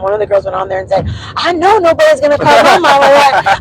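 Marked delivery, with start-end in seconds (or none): 1.4-3.6 high, loud retort in someone else's voice; imitated indignant daughter. Daughter defending her mama online, mock-indignant. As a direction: act out a protective outburst, pitched up.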